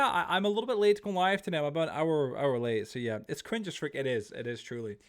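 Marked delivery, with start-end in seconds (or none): none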